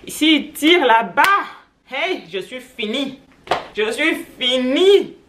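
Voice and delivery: loud, high, drawn-out Cameroonian accent. Real, not acted. Vlogger dreading children tugging at it, exclaiming in Cameroonian accent. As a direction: slip into an emphatic Cameroonian accent, stretching the words. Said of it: "vous switchez parfois de l'accent".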